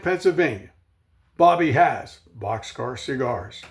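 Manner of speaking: ordinary delivery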